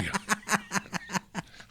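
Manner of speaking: high burst of laughter; retold big high laugh; imitated laugh. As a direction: crack up like the old boss did. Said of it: "And he starts laughing".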